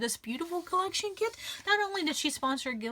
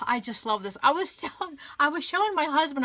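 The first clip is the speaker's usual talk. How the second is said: ordinary talk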